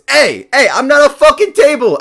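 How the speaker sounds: high, loud furious yell; angry mimic shout. Mimic table shouting at the people who sat on it. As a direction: bellow it high and outraged.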